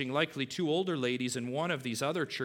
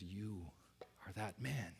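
much softer, solemn verdict; quiet and grave; 5 semitones lower, 13 dB quieter than usual. Retold or imitated. Preacher voicing Nathan telling David quietly and gravely. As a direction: drop the voice to deliver the verdict. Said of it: "you can imagine, we don't have the tone".

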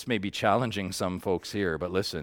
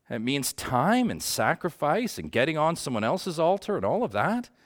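normal talking voice

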